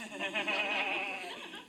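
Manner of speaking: a fond laugh at his own joke; warm chuckle. Real, not acted. Laughing fondly after teasing his kids. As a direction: laugh softly, pleased with himself.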